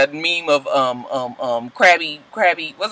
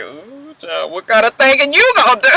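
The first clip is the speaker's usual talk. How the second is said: sassy tease; 7 semitones higher than usual. Pretend. Sassy aside about thanking.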